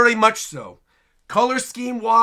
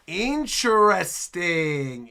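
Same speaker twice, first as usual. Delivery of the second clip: INTERESTING stretched out very slowly; long, pondering drawl; intrigued musing; 3 semitones lower, much slower than usual. Real, not acted. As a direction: draw out every syllable while mulling it over. Mulling over the reveal.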